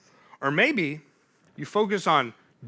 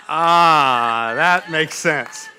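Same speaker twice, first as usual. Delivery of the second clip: slow, drawn-out ah of realization; dawning realization; 8 dB louder, much slower than usual. Retold or imitated. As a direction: let it sink in slowly. Realizing what the phrase meant.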